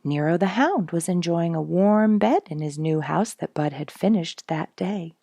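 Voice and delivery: usual speaking voice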